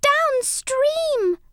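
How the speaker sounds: high, desperate cry; a little mouse shouting as the boat drifts. A narrator's reading. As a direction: call out in panic. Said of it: "shouted Angelina desperately".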